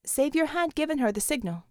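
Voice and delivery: usual speaking voice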